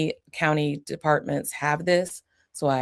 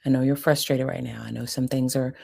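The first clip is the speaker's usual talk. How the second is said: ordinary talk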